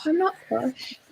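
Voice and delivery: quiet, crisp posh denial; soft posh English delivery; posh English accent. Real, not acted. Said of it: "You're putting it on on purpose".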